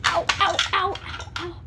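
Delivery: high pained yelps; pained ows. Real, not acted. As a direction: yelp at every step. Walking barefoot on Legos.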